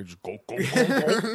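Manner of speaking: mock chugging noise, rising high on the last go; chanted gulps like downing a beer; playful drinking noise; glugging sound effect. Shown as a demonstration. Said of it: "make sound effects like that".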